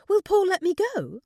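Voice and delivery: high, loud, anxious question; worried high-pitched doubt; hesitant. A narrator's reading. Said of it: "she hesitated".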